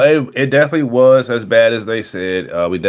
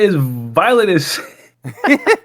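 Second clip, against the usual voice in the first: amazed; 13 semitones higher, slower than usual. Real, not acted. Amazed exclamation, high pitch.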